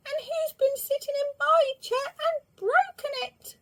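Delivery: very high squeaky cub cry; the little bear crying over his broken chair. A narrator's reading. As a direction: cry it out like a tiny upset cub. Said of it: "cried the little bear".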